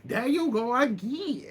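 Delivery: sing-song taunt in a borrowed voice; mocking Candy. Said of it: "She like".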